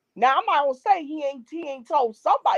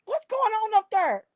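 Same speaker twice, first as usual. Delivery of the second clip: mother calling up; 10 semitones higher than usual. Retold or imitated. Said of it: "My mama said".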